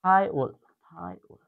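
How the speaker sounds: থায়োল, থায়োল drawn out slowly; slow drill of থায়োল; slow repeat. Shown as a demonstration. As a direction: stretch each থায়োল for the class. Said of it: "এটার উচ্চারণ হবে থায়োল".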